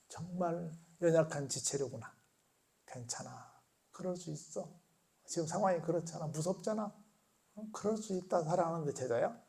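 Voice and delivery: soft, tender reassurance; gentle comfort. Retold or imitated. Jesus gently forgiving Peter, soft and tender. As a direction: comfort a frightened friend quietly. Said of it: "노려보신 눈이 아니라".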